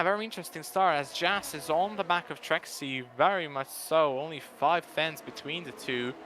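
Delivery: ordinary delivery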